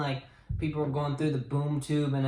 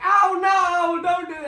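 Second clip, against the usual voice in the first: very high, loud cartoon alarm; retold plea, shrieked for laughs; mock panicked yell; 13 semitones higher, 8 dB louder than usual. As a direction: cry out in hammed-up panic. Acting out a victim's alarm.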